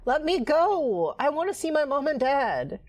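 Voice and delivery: put-on girl crying to be let go, pitched high and slow; high desperate girl. Rachel begs Danny to let her go, high desperate plea. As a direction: plead for your parents, voice up high.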